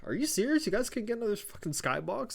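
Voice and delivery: high-pitched mock complaint; imitated indignation. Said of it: "Damage Control's like".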